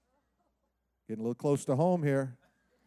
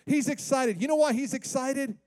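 ordinary talk

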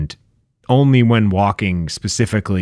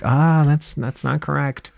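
imitating Monty; 6 semitones higher, slower than usual. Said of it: "Monty's at home with his notepad".

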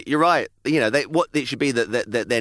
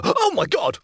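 startled; 10 semitones higher than usual. Retold or imitated. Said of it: "Soph would go".

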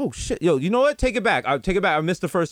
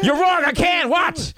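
high, boastful director voice; cocky dare, pitched up; cocky Nolan; 12 semitones higher, slower than usual. Retold or imitated. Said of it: "He's like".

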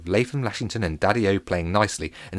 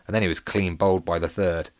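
plain reading voice

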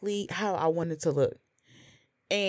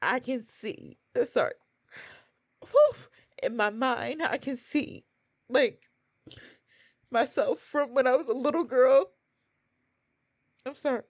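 real choked-up, high-pitched voice; getting emotional; 6 semitones higher than usual. Real, not acted. Remembering her little-girl self, getting emotional. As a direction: fight back tears, stopping to collect yourself. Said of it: "I'm getting emotional thinking about it".

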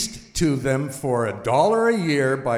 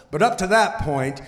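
usual speaking voice

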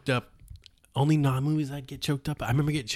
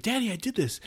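high, needy voice pleading for daddy's praise; whiny eager son; 11 semitones higher, 3 dB quieter than usual. Retold or imitated. Podcaster mimicking the ambitious son seeking his dad's praise, high and whiny. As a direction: squeak it up like a son begging for approval. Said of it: "was, like, the ambitious one".

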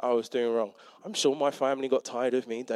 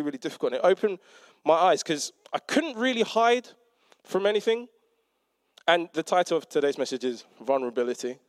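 usual speaking voice

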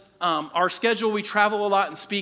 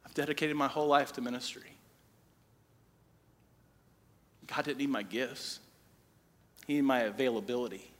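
quiet, choked-up confession; emotional; 5 semitones lower, 6 dB quieter than usual. Real, not acted. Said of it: "it gets me emotional".

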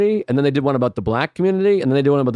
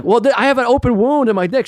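whiny protest; 10 semitones higher, 3 dB louder than usual. Retold or imitated. Retold whiny protest to nurse.

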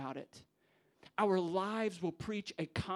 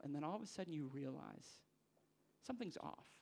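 softer, drawing the room into the moment; hushed storytelling; 6 dB quieter than usual. Acted. Preacher hushes the congregation to paint the wedding, softly.

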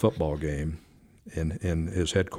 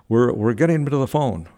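higher-pitched, flustered stammer; mildly excited, raised voice; excited trainer; 5 semitones higher, 4 dB louder than usual. Retold or imitated. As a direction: imitate an eager trainer hurrying to report. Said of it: "He was so excited".

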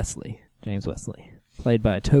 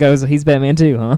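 sarcastic; 3 semitones higher, 7 dB louder than usual. Retold or imitated. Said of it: "It's like".

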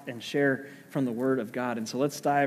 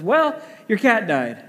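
slow, higher and louder, a touch of bluntness; blunt husband; 6 semitones higher, 4 dB louder than usual. Retold or imitated. Husband bluntly telling his wife the cat died, slow blunt delivery. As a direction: drop the bad news slowly, no cushioning.

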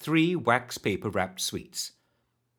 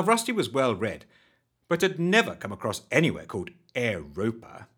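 ordinary delivery, nothing marked

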